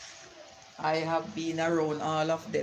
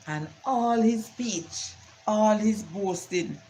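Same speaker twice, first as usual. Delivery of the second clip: mocking; 5 semitones higher, slower than usual. Mocking the father's boasting.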